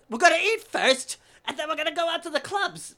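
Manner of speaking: very high, loud, showy proclaiming; flamboyant announcement. Retold or imitated. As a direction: holler the plans as an extravagant host. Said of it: "so extravagant and flamboyant".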